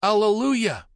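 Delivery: high, loud ecstatic shout; joyful cry. Acted. Monk filled with the Spirit, crying out in joy. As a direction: cry out in rapture.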